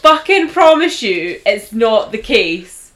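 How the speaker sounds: loud, slow insistence; slow and loud, each word hammered home; hammered, slow retold insistence. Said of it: "I was like".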